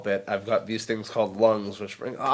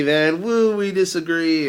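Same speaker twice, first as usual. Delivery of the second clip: mock cheer; 7 semitones higher, 5 dB louder, slower than usual. Mock cheer over disagreeing.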